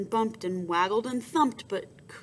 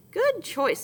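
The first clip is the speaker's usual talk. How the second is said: approving; 9 semitones higher, 4 dB louder than usual. Acted. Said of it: "said the first gator".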